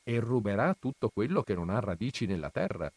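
ordinary delivery

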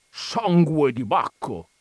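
drawn-out oath of keen interest; interested exclamation. A narrator's reading. Coachman caught up in the war story, mild yell. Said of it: "urlò il cocchiere interessandosi".

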